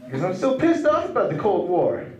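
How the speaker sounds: high, loud, worked-up outrage; mock indignant rant. Comedian tells the crowd he is still angry, loud high rant. Ranting about the Cold War.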